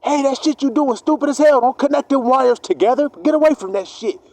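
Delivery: urgent warning. Retold or imitated. Warning someone off the wires.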